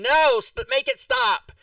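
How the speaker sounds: very high, loud pleading yell; mock-anguished shriek, way up high; retold yelling. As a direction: re-enact a desperate yell at the TV. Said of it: "We're just yelling".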